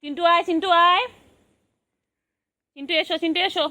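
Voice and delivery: high, quick sing-song call; calling pet. Acted. Owner calling pet over in high sing-song. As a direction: coax the pet to come in a high lilting voice.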